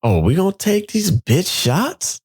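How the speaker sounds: high, slow teasing taunt; teasing challenge. Retold or imitated. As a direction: dare him mockingly. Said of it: "Donna's like".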